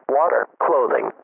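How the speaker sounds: normal talking voice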